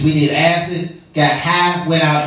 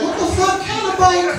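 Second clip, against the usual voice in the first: much higher, incredulous mock rant; mock exasperated; 12 semitones higher than usual. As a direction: throw the question up high in disbelief. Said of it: "And I'll be like".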